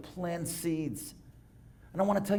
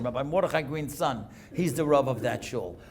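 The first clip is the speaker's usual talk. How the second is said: usual speaking voice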